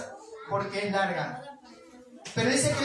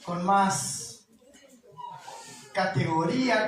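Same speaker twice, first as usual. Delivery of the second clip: slow, mock-dignified correction; 4 semitones lower, much slower than usual. Shown as a demonstration. Preacher teasing the congregation, slow mock-dignified. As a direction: draw out con más categoría slowly, as if polite. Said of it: "no dice con más plata".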